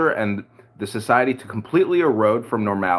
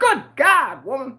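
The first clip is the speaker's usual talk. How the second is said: shocked exclamation; 4 dB louder, slower than usual. Retold or imitated. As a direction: cry out in mock astonishment. Acts out own shocked reply.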